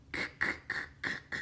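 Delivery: K as a repeated voiceless click, no vowel; isolated K sound. Shown as a demonstration. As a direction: repeat just the soft consonant sound of K. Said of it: "tu entends le k".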